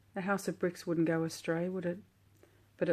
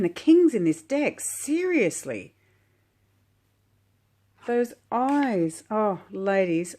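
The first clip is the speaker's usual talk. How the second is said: swooning excitement; 5 semitones higher, 5 dB louder, slower than usual. Real, not acted. Swooning over the King card.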